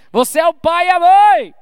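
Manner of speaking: emphatic shout. Real, not acted. Preacher insisting to parents, loud on mãe. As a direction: proclaim it, landing on mãe. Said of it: "repeated for emphasis".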